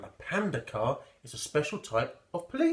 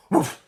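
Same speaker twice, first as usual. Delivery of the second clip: loud barking woof; dog woof; 5 semitones higher, 10 dB louder than usual. Acted. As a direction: bark like a dog. Dog sound in the story.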